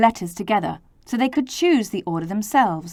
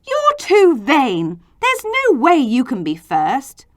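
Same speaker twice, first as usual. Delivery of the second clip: louder, scold the vain letter; shouting letters; 5 semitones higher, 6 dB louder than usual. A narrator's reading. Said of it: "The other letters shouted".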